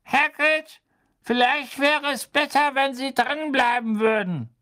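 put-on elderly committee member, high and insistent; old official. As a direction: pitch it high, a thin old man advising.

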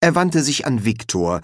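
ordinary delivery, nothing marked

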